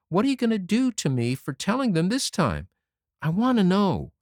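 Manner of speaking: put-on high, boyish voice, cheeky and pleased; a small boy proud of his prank; young Davy. A narrator's reading. Davy boasting to Anne about his scare, boyish high voice.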